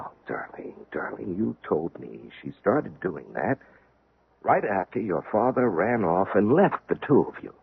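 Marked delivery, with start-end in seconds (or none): 0.0-3.6 soft, hushed comfort; almost breathe it to soothe her; husband hushing his troubled wife, tender and soft. Acted.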